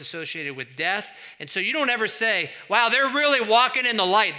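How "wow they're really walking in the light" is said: loud, high-pitched, exaggerated wonder; hammed-up gushing admiration; mock amazement. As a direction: exclaim it as an absurd compliment. Mock remark nobody would say.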